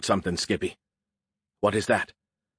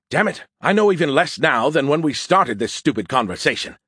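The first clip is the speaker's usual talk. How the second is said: louder, higher exasperation; raised, exasperated voice; frustrated outburst; 5 semitones higher, 5 dB louder than usual. A narrator's reading. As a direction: burst out in frustration. Frustrated outburst in acted argument.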